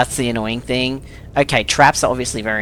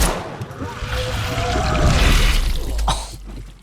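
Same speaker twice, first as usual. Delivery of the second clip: squeaky, breathless disbelieving laugh; high breathy laugh. Real, not acted. After dying in game.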